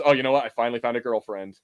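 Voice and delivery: ordinary talk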